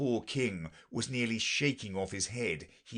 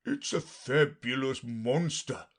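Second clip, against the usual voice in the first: slow, higher proclamation; lightly hammed unicorn announcement; crying out; 5 semitones higher, 3 dB louder than usual. A narrator's reading. Unicorn announcing Alice to the lion, drawn-out higher cry. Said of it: "the unicorn cried out".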